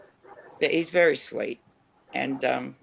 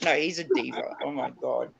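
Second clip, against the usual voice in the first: normal talking voice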